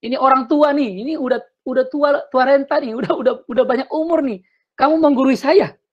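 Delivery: the insulted elder puffing up; offended elder. Retold or imitated. An old Batak man offended by a youngster, indignant. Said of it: "Dia merasa direndahkan".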